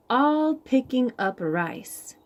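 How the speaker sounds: ordinary delivery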